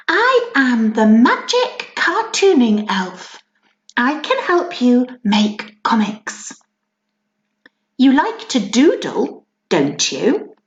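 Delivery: slow, bright storybook elf; magic elf voice. Acted. The magic elf introducing himself to the princess, slow and grand. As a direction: announce yourself as a friendly magic elf.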